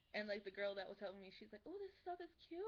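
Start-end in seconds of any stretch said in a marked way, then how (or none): 1.6-2.6 high-pitched cooing imitation; mock girly admiration. Girl helping her pack, high admiring coo. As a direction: lift it way up, imitating an admiring friend.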